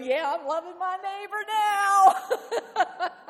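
loud, high, giddy drawn-out cheer; hammed-up joy. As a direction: play the over-the-top happy neighbor waver. Laughing mock of waving congregant.

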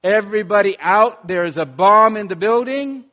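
loud urgent order; fireman shouting. Retold or imitated. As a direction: bark it like clearing a building. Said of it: "runs into a building and says".

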